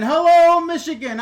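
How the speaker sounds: big, loud, high hello to the viewer; excited greeting. Real, not acted. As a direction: shout a cheerful hello across the room.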